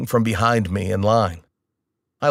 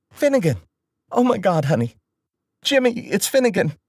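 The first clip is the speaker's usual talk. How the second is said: high, emotional mom after crying; a mother overwhelmed to hear her son; voiced mom, higher and teary with relief; tearful relief; 10 semitones higher than usual. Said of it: "Mom sounded like she'd been crying".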